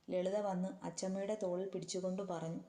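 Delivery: ordinary delivery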